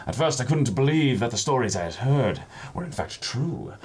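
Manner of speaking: reading a pirate roleplay letter with flair; storybook narration. Acted. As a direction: tell it like a sea yarn.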